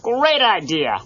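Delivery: chirpy comic bear saying hi; lightly voiced Fozzie.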